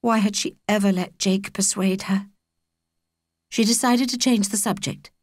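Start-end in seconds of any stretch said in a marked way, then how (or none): none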